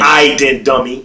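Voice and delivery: West Baltimore accent on "I didn't, dummy". Shown as a demonstration. Repeating the West Baltimore phrase when asked, accent. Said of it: "Say it again".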